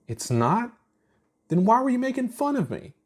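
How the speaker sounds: ask, confused and stung; high hurt confusion. A narrator's reading. Chuck asking Ponder why he mocked her, high and hurt. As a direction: voice jumps high in surprise.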